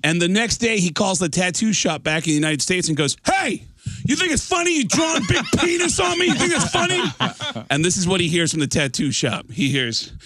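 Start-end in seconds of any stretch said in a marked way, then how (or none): 3.2-7.0 very high, hammed-up angry yell; outraged yelling. Drummer calling the tattoo shop, outraged yelling. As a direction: shriek it in mock outrage.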